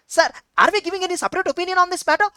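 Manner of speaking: high, quick imitated question; questioning student. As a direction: lightly act an eager questioner. Acts out a questioner addressing sir.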